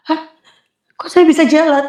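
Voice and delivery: retold amazement; amazed. Amazed at walking again.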